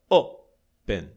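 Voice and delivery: "open", first syllable stressed, second let fall away. Shown as a demonstration. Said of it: "a tônica está na primeira sílaba".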